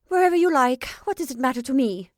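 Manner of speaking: high, loud and careless, tossing it away; supreme indifference. A narrator's reading. Italian woman to the travellers, with loud supreme indifference. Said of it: "with an air of supreme indifference".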